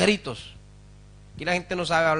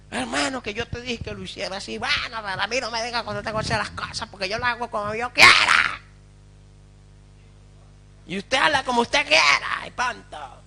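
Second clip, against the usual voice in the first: fast, testy back-and-forth between brothers; hint of an irritated churchgoer snapping back; mock quarrel; 6 semitones higher than usual. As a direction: play both sides of a petty squabble, quickly. Acting two church members quarrelling.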